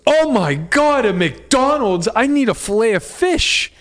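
high, slow, gushing over a McDonald's; mock-excited imitation of his girlfriend; excited wife voice. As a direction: squeal it like she spotted treasure. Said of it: "and she goes".

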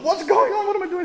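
high, loud, panicky cry; hammed-up sudden fright; mock panic. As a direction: play Peter sinking in alarm. Peter sinking, panicking.

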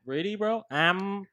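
usual speaking voice

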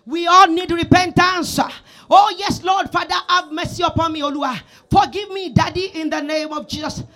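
fervent prayer. Acted. Loud prayer of mercy.

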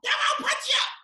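very high squeaky shout; high-voiced [name]. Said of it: "[name] with his high voice".

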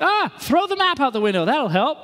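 high-pitched mock exasperation; exasperated outburst. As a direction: act out a lost driver giving up on the map. Acting out lost drivers' reaction.

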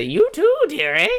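piercing high crone call; shrill, loud falsetto summons; fortune teller. Acted. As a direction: shriek it out in a very high old-lady voice. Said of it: "she's gonna say".

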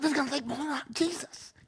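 a joking exaggerated Philip; comic aside voice. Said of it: "It's like".

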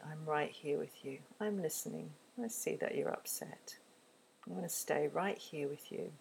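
soft, quiet and soothing; tender presence. Shown as a demonstration. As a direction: hold space for an upset child. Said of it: "That's that quality".

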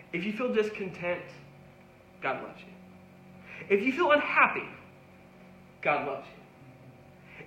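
gentle call-and-answer sermon; tender preaching litany. Acted. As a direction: lift the feeling, then hush the promise.

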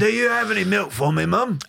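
put-on high English little-boy plea; put-on London accent. Boy asking mum for milk, high London accent. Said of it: "slipping into his old accent".

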